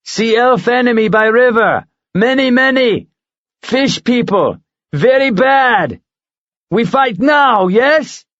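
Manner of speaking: itching to attack the fish people; excited giant eagle. Acted. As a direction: eagle pushing Mara to fight. Eagle eager to fight.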